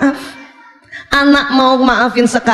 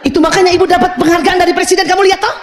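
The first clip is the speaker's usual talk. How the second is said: high, rapid self-justification; hammed-up boasting in a high voice; defensive mother; 9 semitones higher, faster than usual. Mother justifying her work to her child, defensive.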